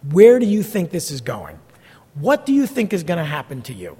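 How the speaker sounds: a hint of a stern grandparent scolding; blunt rebuke. Pastor confronting a young adult about a bad match, mild scolding. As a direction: scold them straight, no tact left. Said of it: "Like, I talk like that".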